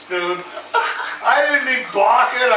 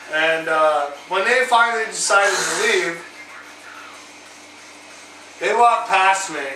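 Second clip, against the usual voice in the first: ordinary delivery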